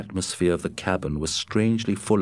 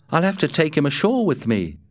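higher, puzzled Matt voice; 9 semitones higher, faster than usual. A narrator's reading. Matt puzzling over the wolf to Scott, higher and puzzled. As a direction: pitch Matt up, wondering aloud. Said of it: "said Matt".

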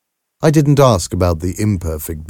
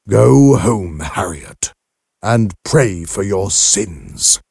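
stern order; slower than usual. A narrator's reading. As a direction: order her away with solemn, drawn-out severity. Father sternly orders her out.

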